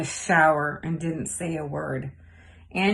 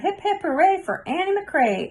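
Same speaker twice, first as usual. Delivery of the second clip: much higher, bright inner cheer; silent cheer; 10 semitones higher than usual. A narrator's reading. Girl cheering to herself in her mind, much higher. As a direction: cheer inside her head. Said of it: "she cheered".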